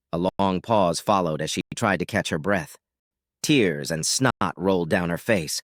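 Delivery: ordinary delivery, nothing marked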